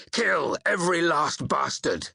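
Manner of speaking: high, savage war cry; battle shout. A narrator's reading. Warrior urging on the men while climbing to the bow, shouted. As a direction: shout it to rouse the fighters. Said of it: "I shouted".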